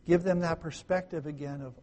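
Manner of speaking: ordinary delivery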